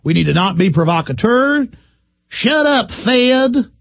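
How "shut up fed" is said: high, sneering mock retort; mock critic. Host parodying hecklers, high scornful retort. Mocking critics yelling at him.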